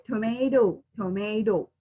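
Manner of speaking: "tomato" as tuh-may-doh; American-style "tomato", tuh-may-doh; American pronunciation. Shown as a demonstration. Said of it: "But in American".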